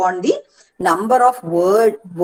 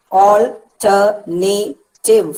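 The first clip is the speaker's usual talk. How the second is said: "alternative" very slowly as al, ter, na, tive; syllable by syllable; much slower than usual. Shown as a demonstration. Teacher counting four syllables for students, syllable by syllable. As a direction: split "alternative" into four separated beats. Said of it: "four syllables".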